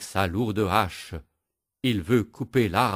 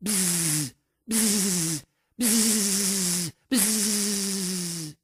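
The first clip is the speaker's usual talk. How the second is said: bee buzz; 11 semitones higher, much slower than usual. Acted. Hundred bees stinging the woodcutter, buzzing. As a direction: buzz like a swarm.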